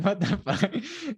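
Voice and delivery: high, drawn-out, laughing disbelief; mock disbelief. Retelling Obi-Wan hearing the con man's offer, mock disbelief. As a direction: stretch it high, cracking up mid-phrase.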